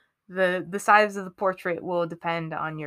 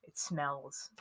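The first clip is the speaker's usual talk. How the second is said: hushed; 6 semitones lower, 13 dB quieter than usual. Real, not acted. Quiet emphasis.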